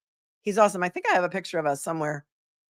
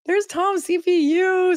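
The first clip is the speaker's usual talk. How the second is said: singsong high greeting; 13 semitones higher, slower than usual. Real, not acted. Greeting a viewer in chat.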